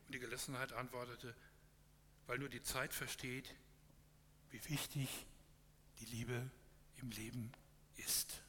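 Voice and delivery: measured storytelling read to a congregation; story narration. A narrator's reading. Pastor reading a parable to the congregation, calm storytelling.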